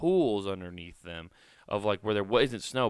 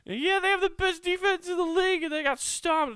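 mocking gloating fan; 5 dB louder than usual. Imitating family crowing about the loss, very high and mocking. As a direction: crow over the blowout. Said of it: "talking shit to me".

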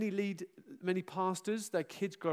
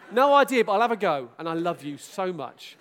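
mock mechanic; 9 dB louder than usual. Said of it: "you can't go".

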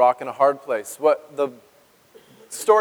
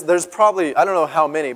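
normal talking voice

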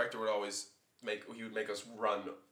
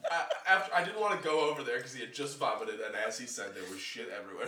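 ordinary delivery

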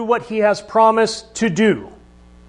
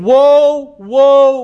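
louder, higher warning cry; loud angel cry; 4 semitones higher, 5 dB louder than usual. Acted. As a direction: sound the alarm like a herald. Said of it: "he's saying".

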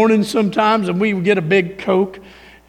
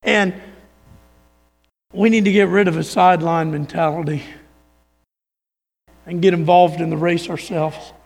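ordinary talk, nothing marked

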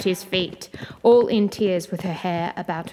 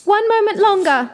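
high, loud cry for time; panicked high call; desperate plea; 13 semitones higher, 7 dB louder than usual. A narrator's reading. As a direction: beg loudly, pitch shooting up. Wife stalls Bluebeard in panic.

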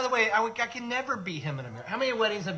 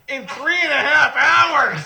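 louder, higher, slow alarmed cry; panicked realization; 7 semitones higher, 9 dB louder, much slower than usual. Retold or imitated. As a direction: shout it in sudden panic. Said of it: "I'm like".

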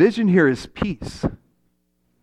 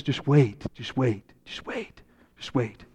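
each just wait softer until a whisper; teacher soothing Will, just wait fading to a whisper; 5 semitones lower, 10 dB quieter than usual. Acted.

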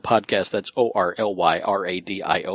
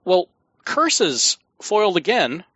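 sputtering foiled villain; 7 semitones higher, slower than usual. Pretend. Host's audio mishap, mock villain line.

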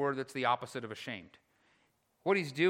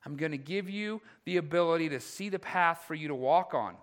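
emphatic pulpit voicing of a promise; impassioned preaching; 6 semitones higher, 7 dB louder than usual. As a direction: promise rising high and loud. Voices the rescuer's promise, preaching.

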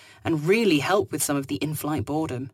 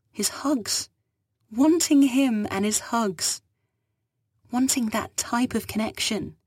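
higher, slow, wistful confession; sighing longing; 6 semitones higher, slower than usual. Acted. Fugitive confesses her longing to the captain, wistful. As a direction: ache for what's lost. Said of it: "She sighed".

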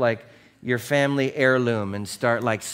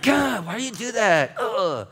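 put-on annoyed teen; 9 semitones higher than usual. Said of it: "They're like".

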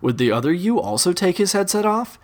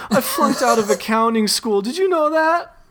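mock sobbing blob; 7 semitones higher than usual. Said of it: "The blob is straight up sobbing now".

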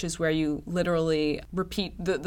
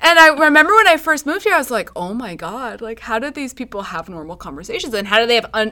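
animated retelling; 6 semitones higher, 6 dB louder than usual. Retold or imitated. Mocking the Bay Area.

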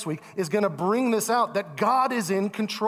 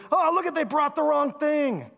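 griping diner; 9 semitones higher than usual. Retold or imitated. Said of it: "And then".

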